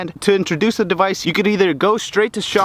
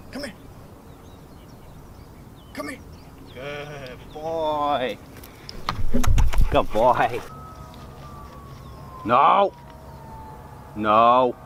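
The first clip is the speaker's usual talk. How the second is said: talking to a dog; 4 semitones lower, 9 dB quieter, much slower than usual. Real, not acted. Commands and praise to dog.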